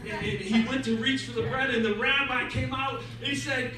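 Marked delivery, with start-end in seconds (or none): none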